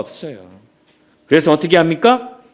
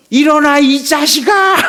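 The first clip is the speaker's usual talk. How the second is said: loud, high, laughing shout of "get up"; coach yelling; 6 semitones higher, 4 dB louder than usual. Retold or imitated. Rocky's coach screams at him from the corner, laughing shout. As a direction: bark at the fighter to get up, half laughing. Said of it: "로키의 코치가".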